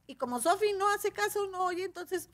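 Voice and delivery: put-on high little-boy voice; little nephew. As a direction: imitate a small child explaining what happened. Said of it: "viene un sobrinito mío".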